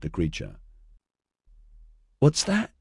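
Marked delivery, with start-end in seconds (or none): 2.2-2.7 small, high-pitched timid question. A narrator's reading. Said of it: "Orion asked timidly".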